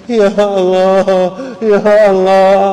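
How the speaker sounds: hammed-up crying prayer that builds in volume; mock weeping prayer. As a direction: wail ya Allah like someone sobbing at the Raudah. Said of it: "kita nangis".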